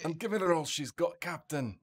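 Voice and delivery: hammed-up starship engineer straining the engines; Scotty. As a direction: engineer reporting to his captain, hammed-up Scotty. Star Trek engineer bit.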